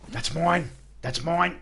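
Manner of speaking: high, excited claiming; possessive whining. Real, not acted. Grabbing loot possessively.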